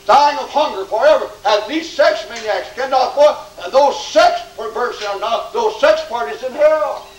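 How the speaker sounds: high-pitched, forceful warning; urgent revival preacher pleading with sinners; impassioned hellfire preaching. Real, not acted. Preaches hell at full force.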